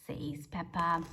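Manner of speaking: ordinary delivery, nothing marked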